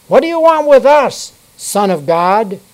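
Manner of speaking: high, loud demon cry; preacher voices the demons shouting at Jesus. A narrator's reading. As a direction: shout it like a possessed man. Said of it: "they shouted".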